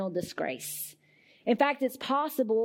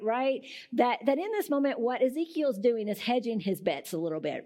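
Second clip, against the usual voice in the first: normal talking voice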